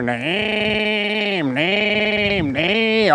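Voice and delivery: slow mock clanking "name, name, name"; imitating machine noise. Neighbor imitating the workshop racket, mock clanking. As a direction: mimic the machinist's tools banging. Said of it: "all day you can hear".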